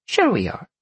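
high-pitched flippant vixen. A narrator's reading. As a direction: agree in a high, mocking tone. Vixen agrees with a wink.

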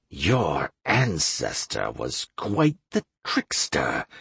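low, slow, seething through teeth. A narrator's reading. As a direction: hiss it out through clenched teeth. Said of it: "Kane seethed through his teeth".

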